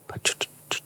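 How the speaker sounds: breathy shushing under the breath; hushed whisper. Retold or imitated. Telling his wife to hush, a soft unvoiced whisper. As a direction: whisper it, hushing them. Hushing in the stadium lodge.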